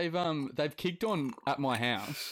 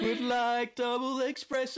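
much higher and slow, laughing through it; mock belted order; 13 semitones higher than usual. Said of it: "Just, like, order your coffee like that".

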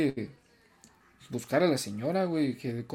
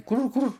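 high pigeon coo; 10 semitones higher than usual. Acted. Pigeon speaking.

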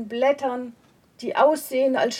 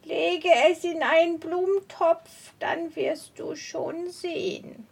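higher, odd witchy voice; witch voice; 4 semitones higher than usual. Acted. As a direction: speak like a sly old witch. Witch advising the woman.

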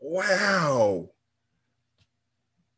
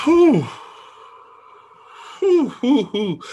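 1 dramatic whew; 10 semitones higher, 6 dB louder, much slower than usual. Real, not acted. Overwhelmed reaction to plot twist.

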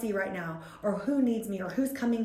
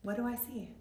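softly repeated private question; quiet, inward murmur; whisper to oneself; 2 semitones higher, 6 dB quieter than usual. Retold or imitated. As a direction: drop the volume, asking only yourself. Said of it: "I've started to whisper to myself".

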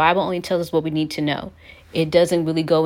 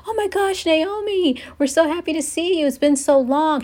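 much higher, gushing happy greeting; overjoyed welcome; 12 semitones higher than usual. Retold or imitated. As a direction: squeal it like excited old friends. Townspeople greeting Naomi back.

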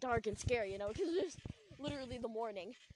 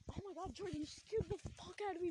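higher, breathless startled gasp of words; mild retold fright, pitched up; 6 semitones higher, 4 dB quieter than usual. Startled by Jordan in dark.

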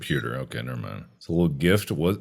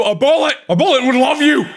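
high, loud threat; menacing high yell; 12 semitones higher, 5 dB louder than usual. Acted. Guard threatening a traveller.

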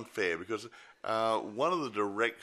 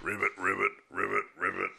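frog voice. Retold or imitated. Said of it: "That's my impersonation of a frog".

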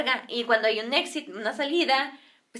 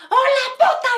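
very high, loud mock yell; glass-breaking shriek; 13 semitones higher, 7 dB louder than usual. Señora yelling her ultimatum, mock glass-breaking shriek. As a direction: imitate a screech fit to shatter glass. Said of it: "ya iba a quebrar hasta el vidrio".

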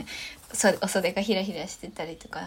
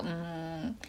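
mumbling to herself; 4 semitones lower, 8 dB quieter than usual. Retold or imitated. Imitating Nana debating eating.

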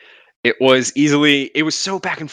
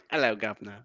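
put-on failed British accent; 3 semitones lower, 11 dB quieter, faster than usual. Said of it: "I, I can't do that".

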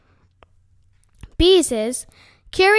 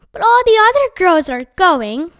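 put-on louder, higher teenage protest; 5 semitones higher, 5 dB louder than usual. A narrator's reading. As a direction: push back at mother, aggrieved. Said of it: "protested Beezus".

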